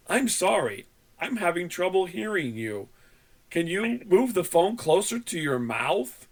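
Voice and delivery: imitated scripted support voice; over-enunciating. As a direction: spell out each word with exaggerated care. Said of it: "but he was over enunciating".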